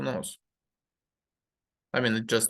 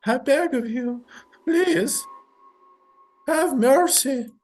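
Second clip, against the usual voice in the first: much higher, louder begging, dragged out; terrified plea; 12 semitones higher, 5 dB louder, slower than usual. Acted. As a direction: scream for mercy in terror. Mother begs for her life.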